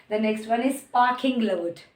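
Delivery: usual speaking voice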